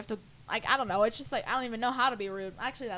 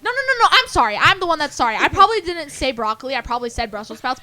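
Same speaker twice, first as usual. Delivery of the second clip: frantic over-apology; 3 semitones higher, 12 dB louder than usual. Pretend. Apologizing to the server over the wrong broccoli, frantic and loud.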